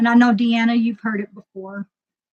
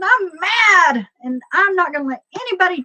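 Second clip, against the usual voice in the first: higher, fast-rushing indignation; retold anger; 9 semitones higher, faster than usual. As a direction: act out fed-up insistence to a cop. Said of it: "like, mad".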